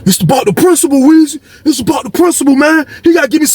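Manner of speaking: lightly voiced high-pitched protest; high, stubborn cellmate. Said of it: "He was like".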